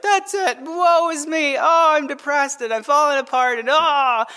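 high-pitched, hammed-up woe-is-me wail; mock falling apart. Preacher imitating someone who gives up under pressure, mock wailing. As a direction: play a melodramatic breakdown. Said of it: "the button where you just fall apart".

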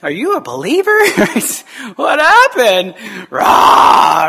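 mock snapping rant. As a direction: play a cranky believer biting someone's head off in jest. Mocking a snappy believer.

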